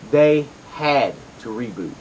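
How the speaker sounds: they had to reboot, slow and deliberate; stress on had. Shown as a demonstration. Host repeats the point to listeners, slowly stressing the reboot was forced. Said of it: "emphasis on the word had".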